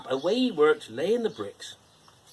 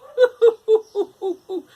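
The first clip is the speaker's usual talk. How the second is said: very high, loud cartoon pig laugh; lazy pigs laughing at their brother; pigs' mocking laugh; 12 dB louder than usual. A narrator's reading. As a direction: cackle in a squeaky pig voice. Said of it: "Laughed the two little pigs again".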